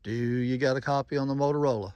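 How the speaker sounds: ordinary talk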